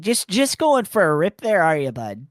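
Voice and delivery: Canadian accent. Shown as a demonstration. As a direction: play the hoser bit. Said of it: "That's the phrase that I was messing up with".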